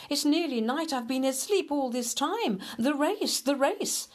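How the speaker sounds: high, panicky and rushed; panicked. Acted. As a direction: panic about missing the race. Hare wakes, panicking.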